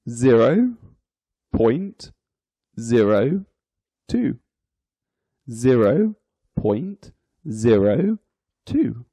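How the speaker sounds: each word set apart by pauses; digit by digit. Shown as a demonstration. Teacher reading a decimal for learners, digit by digit. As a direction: read the decimal out one word at a time. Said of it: "each digit is read individually".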